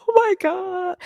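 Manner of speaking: high, shouted oh my God; a sudden high yelp of shock; shocked cry. Retold or imitated. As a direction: cry out in disbelief. Overwhelmed as door opens.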